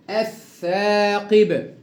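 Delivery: الثاقب with held double th and long aa; lam merged into th. Shown as a demonstration. Teacher drills the second word, lam merged into th. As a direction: recite الثاقب slowly, lam silent. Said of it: "أضغمت اللام في الثاء المشددة".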